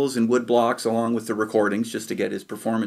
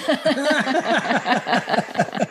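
laughter; 13 semitones higher than usual. Real, not acted. Laughing after the retold yell.

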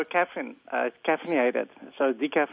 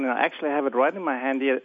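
usual speaking voice

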